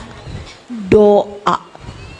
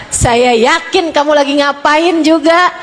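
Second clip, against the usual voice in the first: ordinary delivery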